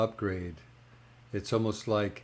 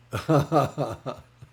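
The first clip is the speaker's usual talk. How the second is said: louder, higher rippling laugh; real laugh; 5 semitones higher, 7 dB louder than usual. Real, not acted. Speaker can't finish describing mind retraining, real amused laugh. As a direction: break off into laughter.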